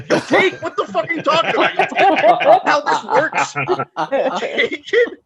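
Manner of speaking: stunned, laughing interrogation; laughing disbelief. Retold or imitated. Incredulous at psychic's answer.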